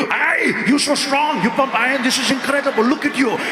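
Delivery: put-on high, excitable Austrian-accented celebrity greeting; Arnold Schwarzenegger. Star greeting a line of fans, gushing impression. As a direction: gush over each fan in a thick action-star accent. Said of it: "When you meet Arnold".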